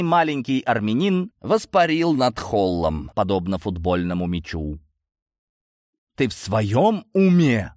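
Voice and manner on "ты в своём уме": slow, drawn-out indignant question. A narrator's reading. Narrator to his foolhardy defender, indignant. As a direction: stretch it in disbelief and outrage. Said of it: "возмутился я".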